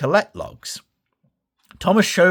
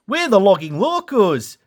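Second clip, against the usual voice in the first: Dash voice; 7 semitones higher, 4 dB louder than usual. Acted. Dash introduces the locos.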